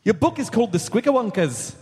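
Scottish attempt slipping into Irish; lilting mock Irish accent. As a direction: put on a light Irish accent. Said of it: "Nope, that's Irish".

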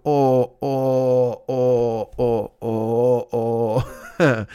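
milk the stomach ache with whiny moans; fake sick moaning. Pretending to Karen his stomach hurts, mock groaning. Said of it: "I would pretend to be more sick than I was".

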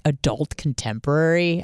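usual speaking voice

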